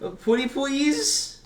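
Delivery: pleading. Pretend. Begging to see the sketch.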